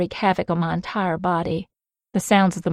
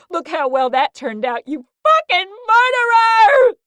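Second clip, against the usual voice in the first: high, loud sobbing fury ending in a shout; crying rage; 11 semitones higher, 5 dB louder than usual. Acted. Mia screaming blame at Creed for the death. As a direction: hurl it at him through tears.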